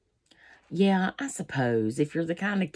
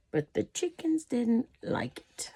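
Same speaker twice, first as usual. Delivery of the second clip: whispering; 7 semitones higher, 5 dB quieter than usual. Acted. Whispered aside while reading.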